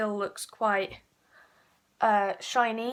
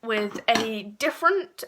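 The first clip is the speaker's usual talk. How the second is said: DIFFERENT drawn out and punched louder; stress on different; 4 dB louder, slower than usual. Real, not acted. Vlogger introducing another brush, heavy emphasis on "different". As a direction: lean slowly and hard on the word "different". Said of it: "emphasis on different".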